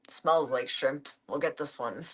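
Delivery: low, mock-puzzled dad voice; imitating dad. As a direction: lightly play the clueless dad at the store. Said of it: "he's like".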